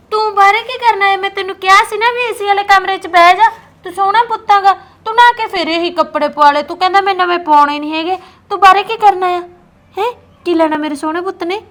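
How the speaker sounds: put-on loud calling to a child. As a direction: call the kid in from across the yard. Calls out to child outdoors.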